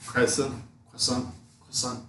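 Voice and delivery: croissant said kris-ant, the Tom Holland way; lightly mocked kris-ant; imitating Spider-Man. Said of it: "That's how he says it".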